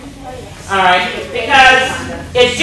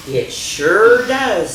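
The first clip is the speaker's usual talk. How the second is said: slow agreement; much slower than usual. Acted. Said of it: "says Ruth".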